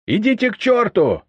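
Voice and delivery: high, loud furious retort; angry. A narrator's reading. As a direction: shout back in rage. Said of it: "сердитый голос повара". (сердитый крик)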